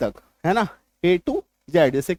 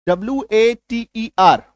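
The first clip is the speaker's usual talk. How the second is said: spelling slowly. Shown as a demonstration. Teacher spelling the word water, slow. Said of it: "देखिए कैसे change होता है".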